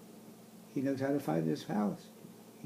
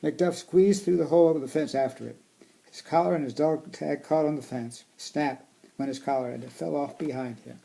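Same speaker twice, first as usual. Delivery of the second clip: storybook reading voice; reading to children; 10 dB louder than usual. Acted. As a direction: read it aloud to kids. Reading picture book aloud.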